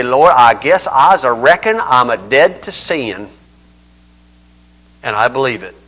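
Appalachian accent. Shown as a demonstration. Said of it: "I guess I gotta be Huck Finn coming up here".

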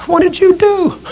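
very high, wide-eyed acted question; high-pitched alarm. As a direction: ask in mock alarm, pitch way up.

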